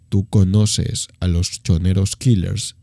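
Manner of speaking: low, slow, menacing thug voice. Retold or imitated. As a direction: drop the voice low and drag it out like a threat. Gang member repeats threat. (pandillero amenazante, voz grave y lenta)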